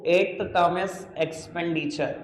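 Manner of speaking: very slow, word by word for writing; slow dictation. Shown as a demonstration. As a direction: dictate each word so they can copy it. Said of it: "मैं पूरा लिख नहीं रहा". (धीरे-धीरे लिखवाना)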